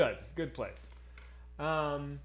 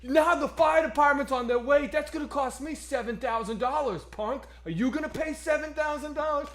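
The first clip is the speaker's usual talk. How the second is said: higher, loud shouted tough-guy rant; angry Brooklyn manager; 9 semitones higher, 5 dB louder than usual. Retold or imitated. Manager scolding him before the restaurant, loud tough-guy shout. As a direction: yell at the diner like a furious Brooklyn boss. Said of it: "He's like".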